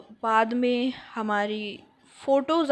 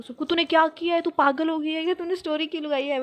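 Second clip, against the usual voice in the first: higher, louder alarmed friend on the phone; imitating upset friend; 5 semitones higher, 6 dB louder than usual. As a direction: friend scolding, half-mocked and worked up. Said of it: "मेरी दोस्त phone कर रही थी".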